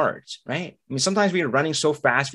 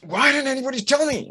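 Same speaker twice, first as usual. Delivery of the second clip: high, exasperated cry; mock outrage at being left out; indignant; 13 semitones higher than usual. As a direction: throw up your hands in outrage. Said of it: "And I'll say".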